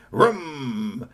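imitated engine rumble; engine vroom. Truck starting, imitated engine vroom. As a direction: make the engine noise.

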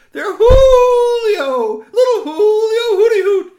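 high, loud, slow joyful shout; overjoyed cry. Acted. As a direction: cry out in delight at the found baby. Owls see their lost baby.

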